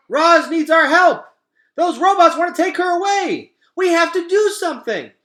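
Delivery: very high, loud wild cries; shouting animals. Acted. Forest animals rallying to help Roz, loud cries. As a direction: yell like a frantic forest creature.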